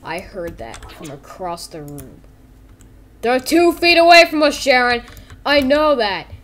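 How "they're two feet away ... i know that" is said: raised, higher exasperated voice; exasperated retort. Acted. Coworkers bickering about Fredbear's song, loud exasperation. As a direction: answer back loudly.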